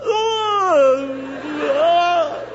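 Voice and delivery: loud, high mock wail; hammed-up wailing sob; Robert De Niro crying. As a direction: bawl in two long open cries. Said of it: "Robert De Niro kind of a".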